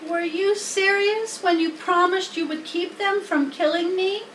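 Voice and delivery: a worried piglet begging for hope; young pig Wilbur. Acted. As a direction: Wilbur asks the spider to save him. Wilbur voiced by reader.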